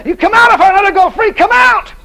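high, loud shouted command; preacher yelling come out. Retold or imitated. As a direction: roar at the demon to leave. Retold command to devil.

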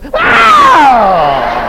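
loud thrilled wow; delighted shout. Retold or imitated. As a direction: shout it with joy. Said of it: "and she goes".

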